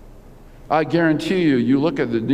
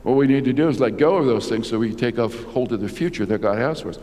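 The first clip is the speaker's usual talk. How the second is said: normal talking voice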